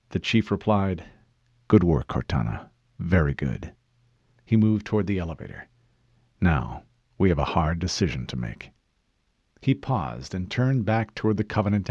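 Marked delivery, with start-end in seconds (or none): 1.7-3.7 deep, restrained praise; low commander. Acted. Master Chief praises his AI, low and restrained. 6.4-8.7 deep, grave-voiced officer; low commander. Master Chief turns to a hard decision, low and controlled.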